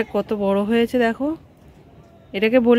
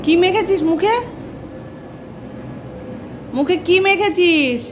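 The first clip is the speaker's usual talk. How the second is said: surprised scolding; 7 semitones higher than usual. Real, not acted. Mother finds child's face smeared.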